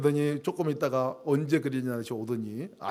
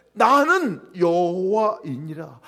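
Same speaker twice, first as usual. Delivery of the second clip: very slow, louder, weighty; solemn divine voice; 2 semitones higher, 8 dB louder, much slower than usual. Pretend. Preacher reading God's words to Moses, solemn and slow. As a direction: intone it like scripture. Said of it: "모세에게 말씀하시되 이르시되".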